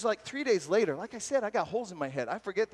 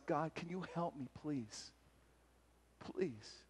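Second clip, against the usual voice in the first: quiet begging, fading to almost nothing; soft pleading prayer; 12 dB quieter, slower than usual. Retold or imitated. A friend quietly begging God for help, soft plea.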